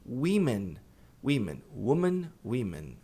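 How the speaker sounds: "women" against "woman", vowels contrasted; English "women". Shown as a demonstration. Teacher contrasts "women" and "woman". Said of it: "plural mulheres".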